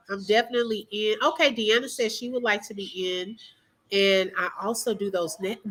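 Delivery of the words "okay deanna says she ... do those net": normal talking voice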